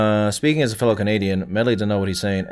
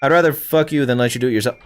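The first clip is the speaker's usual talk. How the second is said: ordinary delivery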